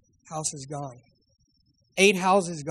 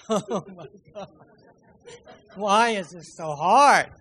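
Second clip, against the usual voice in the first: louder, slowed groan of frustration; exasperated; 5 dB louder than usual. Retold or imitated. Pastor parked at Safeway, complaining to God, exasperated. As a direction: whine it to the sky, half-laughing.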